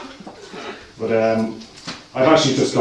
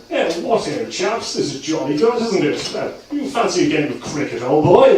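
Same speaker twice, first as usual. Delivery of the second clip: hammed-up plummy English toff, pitched up; posh English accent; 7 semitones higher than usual. Dublin amateur actor hamming a posh English stage role. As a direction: play the upper-class Englishman with relish. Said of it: "put on a very posh English accent".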